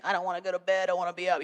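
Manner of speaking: a light imitation of a child whining; mock-whine it like a kid at bedtime; whiny child. Lightly voiced daughter whining at bedtime, mock whiny. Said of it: "it's usually whining".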